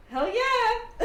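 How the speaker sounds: high, loud whoop of joy; thrilled cheer. Acted. Elf reunited with his old general, thrilled cheer.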